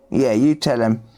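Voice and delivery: ordinary talk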